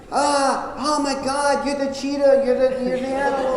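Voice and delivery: thrilled eureka outburst; excited discovery. Retold or imitated. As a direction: shout it like you just cracked the case. Husband excitedly retells realizing.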